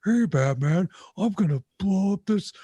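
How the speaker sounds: muffled, menacing villain voice; Bane voice. Retold or imitated. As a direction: growl it like a masked brute. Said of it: "just talking like that for no reason".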